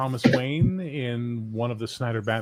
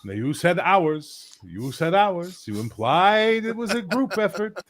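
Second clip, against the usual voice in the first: slow, higher, drawn-out protest; mock accusing; 7 semitones higher than usual. Co-host accusing his friend over "ours", mock indignant. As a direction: climb in pitch with mock indignation.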